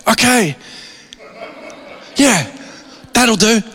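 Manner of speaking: high, mock-eager agreement; mock relief. Imagined pastor accepting escape advice, high and mock-relieved. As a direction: chirp it like an easy way out.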